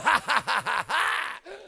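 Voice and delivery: loud, long mock cackle; hammed-up, triumphant ha-ha-ha; re-enacted taunting laugh. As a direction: laugh on and on at the gunman. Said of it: "When I said that and started laughing".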